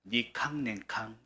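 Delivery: slow Gyeongsang dialect phrase. Shown as a demonstration. Pastor telling congregation all share the wilderness, in Gyeongsang dialect. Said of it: "경상도 사투리로".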